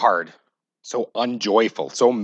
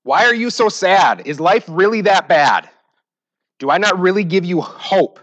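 grab them by the shoulders and demand an answer; God shaking you, loud; 8 semitones higher, 7 dB louder than usual. Shown as a demonstration. God shaking a despairing believer by the shoulders, loud and pleading. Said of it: "another tone that that verse could be read".

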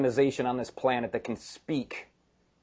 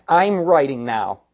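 louder, emphatic declaration building to the signature; Paul taking the pen; 5 semitones higher, 8 dB louder than usual. Retold or imitated. Preacher voices Paul grabbing the pen from his secretary, emphatic. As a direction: declare it firmly, landing hard on the name. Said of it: "He grabs the pen and he goes".

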